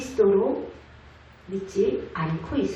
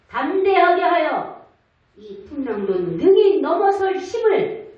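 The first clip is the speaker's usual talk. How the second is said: rising, higher and louder assurance; preaching God's promise; 5 semitones higher, 4 dB louder than usual. Acted. Pastor speaking as God to a frightened believer, fervent. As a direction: preach it as God comforting you.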